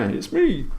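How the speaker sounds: very high, put-on squeak; a teasing squeaky impersonation of the viewer; squeaky mock voice. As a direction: squeak it like a cartoon.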